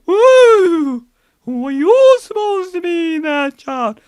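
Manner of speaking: high wailing ghost voice; spooky ghost greeting trick-or-treaters; ghost at the door. Pretend. Real ghost answering trick-or-treaters, high wail.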